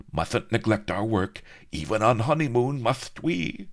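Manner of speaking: high, gleeful gloating list; gloating viceroy. A narrator's reading. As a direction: relish every cruel plan. Viceroy gloats over wedding plans.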